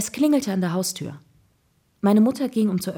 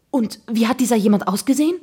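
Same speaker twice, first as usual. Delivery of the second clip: louder, higher eager question; breathless; 5 semitones higher, 7 dB louder than usual. A narrator's reading. Boy desperate to hear about the suspect, breathless excitement. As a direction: ask urgently, rising with suspense. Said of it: "fragte ich atemlos".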